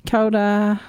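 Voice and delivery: normal talking voice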